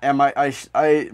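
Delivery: ordinary talk, nothing marked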